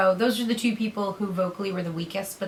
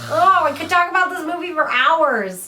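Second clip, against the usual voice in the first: much higher and louder, thrilled; gushing excitement; 8 semitones higher, 6 dB louder than usual. Real, not acted. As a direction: fan squealing over the movie. Gushing about the movie's kids.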